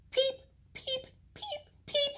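tiny squeaky peeping chick; very high, thin baby bird peeps. Acted. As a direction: peep like a little chick inside the egg.

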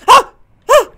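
short startled cry; sharp "ah" of fright; startled yelp. Real, not acted. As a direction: yelp at a jump scare. Jump scare in horror game.

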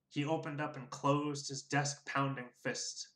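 ordinary delivery

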